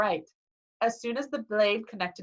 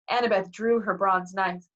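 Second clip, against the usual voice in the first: ordinary delivery, nothing marked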